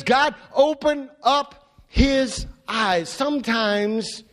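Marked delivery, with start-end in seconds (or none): 0.0-3.1 slow, earnest prayer. Retold or imitated. Elisha praying for his servant, slow earnest prayer. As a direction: ask God earnestly, slowly. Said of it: "he says".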